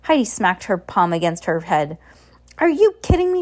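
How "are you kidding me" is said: high, loud disbelief; incredulous cry. Acted. Heidi amazed her friend doubted her, crying out. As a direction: cry out, astonished. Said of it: "she cried".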